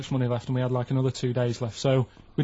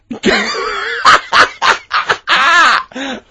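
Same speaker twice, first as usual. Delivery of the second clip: loud, high burst of laughter; shrieking laugh; 12 dB louder than usual. Real, not acted. Hearing about crabs on the beach, loud shrieking laughter. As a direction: explode into uncontrollable high cackling.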